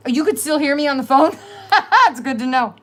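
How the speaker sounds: high, loud, laughing disbelief; laughing surprise. Real, not acted. Laughing to viewers about the phone call. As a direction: burst out laughing at being overheard. Caller still heard on phone.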